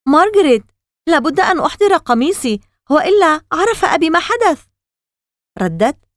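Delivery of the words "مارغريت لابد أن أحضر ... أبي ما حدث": high-pitched urgent exclamation; crying out. A narrator's reading. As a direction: call out urgently to a sister. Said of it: "صح كيسي". (صياح ملحّ بنبرة عالية)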